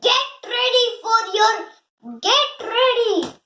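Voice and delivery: high-pitched, mildly loud scolding. A narrator's reading. Master scolding the dog waiting near the door, high-pitched telling-off. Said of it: "the master scolded him".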